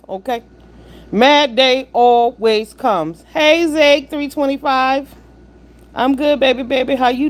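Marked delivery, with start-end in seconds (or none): 1.1-3.2 slow warning. Real, not acted. Slow proverb-like pronouncement.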